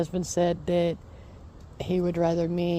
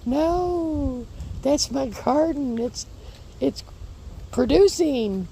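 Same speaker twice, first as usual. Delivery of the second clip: indignant protest; 8 semitones higher, slower than usual. Retold or imitated. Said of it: "And I said".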